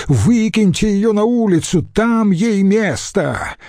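high-pitched, indignant order; angry shouting. A narrator's reading. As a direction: demand her removal in a high, outraged cry. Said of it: "закричал он". (гневный крик)

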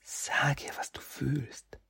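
high, hushed whispering voice; whispering spirit. Acted. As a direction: breathe it into his ear like a tempting spirit. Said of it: "Die säuselnde Stimme Kitsunes".

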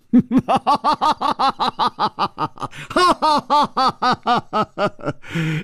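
long, high, hearty ha-ha-ha; sudden big laugh. A narrator's reading. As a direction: burst into prolonged theatrical laughter. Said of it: "楚留香忽然大笑".

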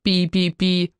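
even, level spoken beeps; censor beep imitation. A narrator's reading. Narrator mimicking bleeps over Miller's swearing, censor beep imitation. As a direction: imitate a broadcast bleep on one steady note. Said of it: "большую часть пришлось бы заменить на".

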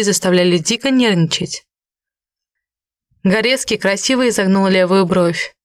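ordinary delivery, nothing marked

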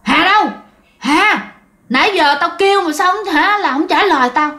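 a hint of an eerie man's voice demanding Hà; gruff male ghost voice. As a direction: bark it like an angry phantom man on the radio. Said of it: "giọng ồm ồm của đàn ông".

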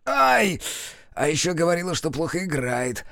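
a sharp yelp, then a mild sulky grumble; hurt grumble. A narrator's reading. The centaur, just flicked on the forehead, grumbles in mild hurt. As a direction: complain like a sore loser, lightly. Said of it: "обиженно проворчал".

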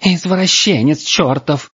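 high, drawn-out hysterical wail; outraged girl, high and hysterical; hysterical yelling. A narrator's reading. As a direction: shriek abuse at your captor. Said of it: "истерично вопила девушка".

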